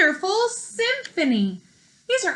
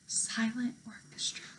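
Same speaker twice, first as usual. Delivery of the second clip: breathy hush on the words; whisper; 13 dB quieter than usual. Acted. Teacher reading a word pair to children, whispered. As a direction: drop to a whisper for silent.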